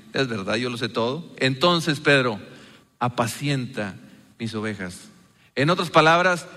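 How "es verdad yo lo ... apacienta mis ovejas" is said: soft, lower smiling reassurance; smiling tender Jesus. Pretend. Jesus gently tells Peter to feed his sheep, softly smiling. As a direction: say it with a gentle smile to a forgiven friend. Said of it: "puedo imaginarme a Jesús sonriendo, diciendo".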